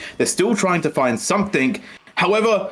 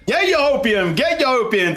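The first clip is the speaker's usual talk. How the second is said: hawking like a street trader; market hollering; 8 semitones higher than usual. Pretend. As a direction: call out like a market seller. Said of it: "I'm now the hopium dealer".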